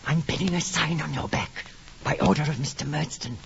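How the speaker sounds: whisper. Acted. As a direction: hiss it softly, menacing. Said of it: "who never spoke above a whisper".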